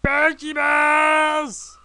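high, loud, stretched-out holler; silly battle cry. Real, not acted. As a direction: holler it in a goofy drawn-out way. Silly yelled Pokeball call.

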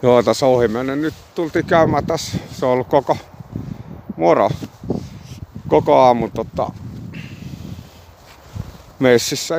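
talking around a cigarette; cigarette in mouth. Real, not acted. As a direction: lips gripping a cigarette. Seen 0: Cigarette held between lips throughout, while talking outdoors.

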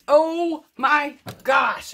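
high, loud, slow dismayed cry; drawn-out shock at a spill; startled gasp. Real, not acted. Painter spilling her red paint, high, loud dismayed cry.

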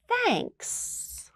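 a shy little bear's thanks. Acted. Bear thanking Mouse for the pie, shy and high. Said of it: "and Bear says".

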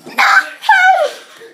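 high-pitched burst of laughter. Real, not acted. Laughs at the answer fish supper.

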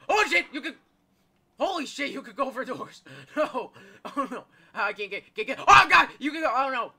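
loud, high, half-laughing alarm; panicked shrieking. Real, not acted. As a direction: yell in panic while being chased. Chased through doors by the monster.

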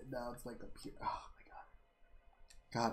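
breathless slow whisper. Real, not acted. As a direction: whisper it in disbelief. Shocked by his stats.

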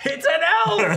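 high, loud, laughing yell; hammed-up outcry. Player heckling over a failed sleep spell, mock yell. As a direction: yell it as a gleeful mock protest.

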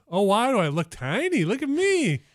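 put-on high, squeaky little-boy act; little kid. Host pretends he has shrunk, little kid voice. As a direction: play a shrunken man as a small child. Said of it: "I'm a little kid".

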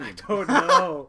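slow, loud laughing shrug. Real, not acted. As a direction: laugh it out helplessly. Laughing at confusion.

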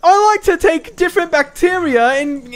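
high, slow, mock-British storyteller; goofy author voice. Mocking the friend's long guide reading, goofy author voice. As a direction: ramble pompously in a high goofy voice. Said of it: "It's just a goofy voice".

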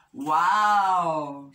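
loud, drawn-out wow; big storybook amazement; amazed wow. A narrator's reading. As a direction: let the wow swell out. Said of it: "said Little Bear".

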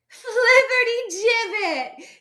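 high, loud, drawn-out scornful yell. A narrator's reading. Flo scolding foodie Fosdyke, yelling. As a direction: yell the insult, stretching it out. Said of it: "yelled Flo".

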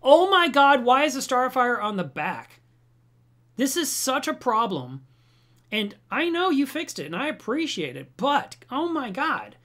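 high, loud, pleading complaint; exasperated rant. Retold or imitated. Writing to Marineland at two in the morning, loud exasperation. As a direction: vent it all out, frantic and upset. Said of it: "I was like".

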